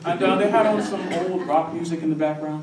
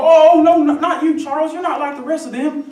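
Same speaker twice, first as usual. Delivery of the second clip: loud, high mock reassurance; backtracking gamer; 12 semitones higher, 10 dB louder than usual. Gamers hastily exempting Charles, loud high mimicry. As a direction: backpedal in a high, emphatic voice. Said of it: "they go".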